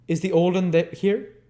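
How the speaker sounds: loud, high call into the dark. Acted. Sikes calls.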